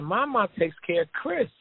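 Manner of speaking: put-on high, matter-of-fact girl voice; young daughter's voice. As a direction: explain it like a kid who thinks it's obvious. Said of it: "She said".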